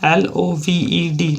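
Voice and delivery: slowly spelling "एल ओ वी ई डी", each letter apart; spelled out letter by letter. Shown as a demonstration. Teacher spelling out loved for students, slow. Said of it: "next example है हमारा लव्ड".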